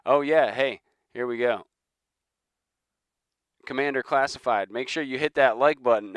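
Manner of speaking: loud, high, eager shout-out; excited. Real, not acted. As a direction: call out happily to a viewer. Greeting a viewer in chat.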